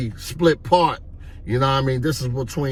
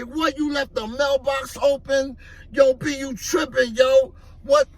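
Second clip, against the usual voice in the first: high-pitched disbelieving scolding; acted incredulous outburst; 10 semitones higher than usual. Hustler learning his partner left the mailbox open, acted incredulous outburst.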